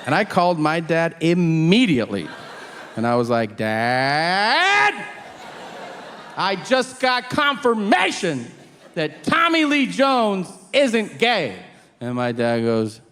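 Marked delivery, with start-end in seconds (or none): 3.6-11.6 high, slow, hammed-up outrage; exasperated, high-pitched accusing announcement; outraged confrontation. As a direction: stretch it out, confronting your dad. Acted outraged phone call to dad.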